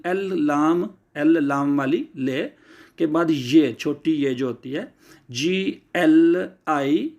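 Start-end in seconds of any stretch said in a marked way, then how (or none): 5.3-7.1 GLI spelled out very slowly letter by letter. Shown as a demonstration. Teacher to students: spelling the letter group slowly. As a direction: draw out each letter of GLI. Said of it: "लिए की आवाज़ देगी".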